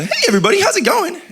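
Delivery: squeaky cartoonish imitation of a friendly host; imitating Randy. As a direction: ham up a buddy's cheery hello. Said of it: "That was my best impression of a Randy".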